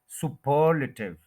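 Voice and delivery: Superlative as su-PER-luh-tiv; superlative with heavy second syllable, quick weak luh; stress on second syllable. Shown as a demonstration. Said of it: "second के ऊपर stress है".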